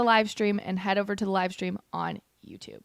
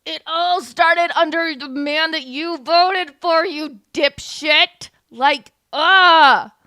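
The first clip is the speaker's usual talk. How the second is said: loud, slow, higher furious rant; infuriated rant; 5 semitones higher, 8 dB louder, much slower than usual. Real, not acted. Streamer ranting at the candidate's voters, loud and furious. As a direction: vent the fury at her voters. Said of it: "it's infuriating".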